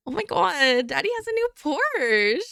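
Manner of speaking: high-pitched, exaggerated rich daddy's girl; fake Valley girl. Streamer reading a chat line aloud, fake Valley girl voice. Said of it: "need to read that in the voice".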